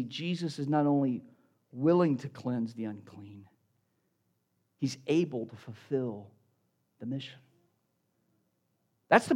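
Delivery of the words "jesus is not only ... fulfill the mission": low, soft, measured reveal; hushed key point. Real, not acted. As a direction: drop way down and let the point land quietly. Key point first given hushed, then repeated.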